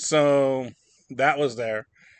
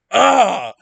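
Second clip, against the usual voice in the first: high, loud "Ah!"; reenacted scream of shock; startled yell; 9 semitones higher, 14 dB louder than usual. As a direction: yelp as if suddenly shot. Retold startled scream at popped bag.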